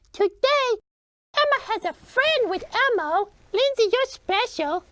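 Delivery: ordinary talk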